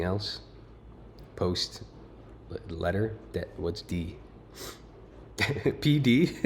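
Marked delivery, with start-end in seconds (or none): 5.4-6.5 laughing in disbelief. Real, not acted. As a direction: chuckle through the words. Laughing at the letter.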